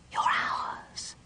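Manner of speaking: soft, hissing ghost whisper; ghostly whisper. Acted. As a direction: breathe it like a hungry spirit. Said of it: "whispered soft, hissing voices".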